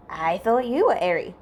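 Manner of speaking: a wary, doubting question; mildly suspicious challenge. A narrator's reading. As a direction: let some distrust creep in. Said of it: "Hisashi says suspiciously".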